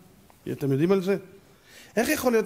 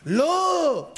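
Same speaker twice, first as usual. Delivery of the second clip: high, drawn-out shouted no; long indignant wail; shouted objection; 10 semitones higher than usual. Acted. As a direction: cry out in protest, pitched way up. Shouted objection in acted argument.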